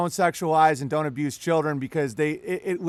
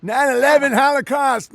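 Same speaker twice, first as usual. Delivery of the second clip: higher, louder mock trigger-word rant; hammed-up alarmist voice, higher and louder; 7 semitones higher, 6 dB louder than usual. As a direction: parody a breathless list of buzzwords.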